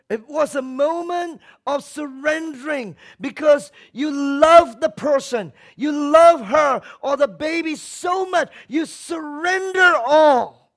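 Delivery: loud, high, at full force; preaching shout. Real, not acted. Preacher rousing the church, full shout. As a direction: shout it to the rafters.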